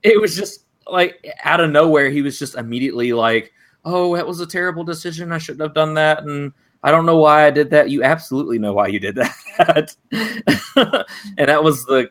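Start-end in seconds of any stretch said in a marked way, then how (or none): 3.8-7.9 higher, mocking whiny regret; mock remorse. Podcaster mocking the son's regret, whiny. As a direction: parody the son's fake regret.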